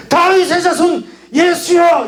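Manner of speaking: high, drawn-out cry for help; desperate cry. Shown as a demonstration. Preacher shows the blind man calling Jesus, high desperate cry. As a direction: cry it out high and slow, straining to be heard. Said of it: "이렇게 외쳤겠죠?".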